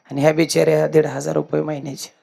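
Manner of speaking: ordinary talk, nothing marked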